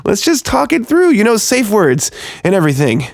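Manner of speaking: trapped photographer desperately bargaining with his captors, pitch climbing. A narrator's reading. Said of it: "I said desperately".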